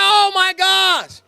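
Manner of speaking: slow, drawn-out, high, loud exclamation; overjoyed oh my gosh shouted out; amazed shout. Retold or imitated. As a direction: let the wonder burst out of you. Euphoric touchdown catch.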